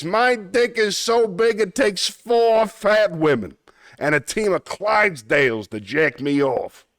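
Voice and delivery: cartoon Brooklyn wiseguy accent; Brooklyn tough guy. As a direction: boast it like a Looney Tunes Brooklyn tough guy. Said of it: "Yes, it's a Brooklyn accent".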